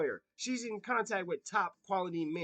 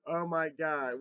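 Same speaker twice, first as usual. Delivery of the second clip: ordinary talk, nothing marked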